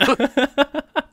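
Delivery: high, loud burst of laughing; real laugh bursting out; burst of laughter. Real, not acted. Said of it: "I burst out laughing".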